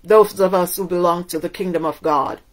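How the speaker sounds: normal talking voice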